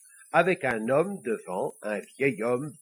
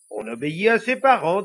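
loud, higher automatic answer; unthinking reply; 5 semitones higher, 8 dB louder than usual. Acted. Priest answering the little devil without thinking, loud and automatic. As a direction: blurt out the stock grown-up answer. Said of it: "sans réfléchir".

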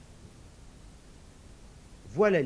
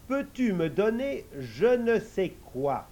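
slow hopeful request; 6 semitones higher, 4 dB louder than usual. Acted. Idiot asks slowly.

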